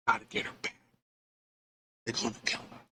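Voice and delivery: whispered, pressing warning; urgent whisper. Retold or imitated. Whispering to the group that they're gonna kill her.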